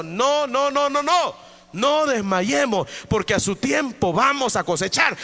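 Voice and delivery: loud, high fiery preaching; preaching at full force. Acted. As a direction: drive it home to the church. Preacher exhorting congregation. (predicación ardiente y fuerte)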